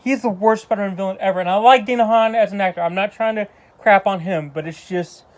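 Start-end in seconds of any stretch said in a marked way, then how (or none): none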